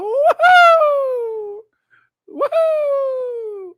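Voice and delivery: very high, loud imitated whoop; mock whoop. Mocking a member's gleeful rant, loud cheering whoop. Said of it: "he's gonna go".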